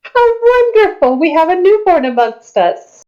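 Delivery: high, lilting cooing voice; delighted ancient being. Acted. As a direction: gush over the newcomer in a high voice.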